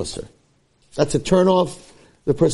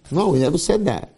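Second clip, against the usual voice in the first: usual speaking voice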